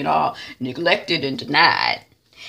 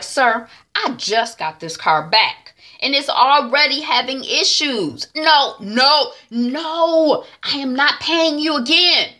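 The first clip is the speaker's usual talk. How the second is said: higher, louder, emphatic refusals; indignant phone complaint; 8 semitones higher, 6 dB louder, slower than usual. Retold or imitated. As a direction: argue with a mechanic, fed up. T Y argues with mechanic by phone.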